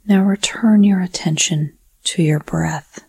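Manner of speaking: normal talking voice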